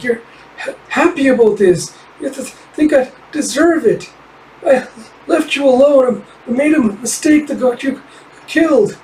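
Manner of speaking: put-on a small fox girl blaming herself, voice high and strained. Kippa's tearful accusation to spirit.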